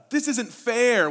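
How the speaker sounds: put-on high, loud and slow, a child's indignant whine; mock angry protest. Pastor imitating someone angry, slow high indignant protest. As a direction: stretch it out like a wronged kid. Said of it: "when we get angry".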